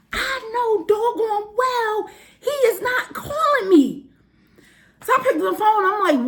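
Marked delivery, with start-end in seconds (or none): 0.1-4.1 high, drawn-out incredulity; retold outraged amazement; indignant disbelief. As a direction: stretch it out, utterly incredulous. Retold disbelief at phone ringing.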